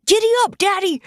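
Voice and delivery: put-on high, loud child call. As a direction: holler it like a giddy kid. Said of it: "Luke calls".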